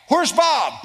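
high, loud startled shout; alarmed call. Retold or imitated. Alarm at empty bed.

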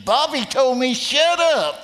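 high, loud, slow amused quote; imitating Bob laughing. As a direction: quote him through laughter. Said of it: "He goes".

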